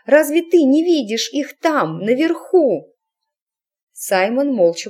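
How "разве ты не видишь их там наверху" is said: raised voice into an ear; shouting. A narrator's reading. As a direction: call out urgently. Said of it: "крикнула она ему в ухо".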